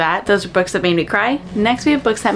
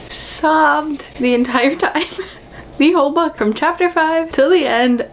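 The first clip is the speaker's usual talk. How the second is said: higher, laughing through the words; 7 semitones higher than usual. Real, not acted. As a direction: giggle while recounting. Laughing while recalling sobbing.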